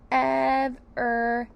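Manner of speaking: "Ev-er-e" loud, in three held beats; syllable by syllable. Shown as a demonstration. Teacher adds the next syllable, stretched and separated. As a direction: hold each part of "Ev-er-e" apart.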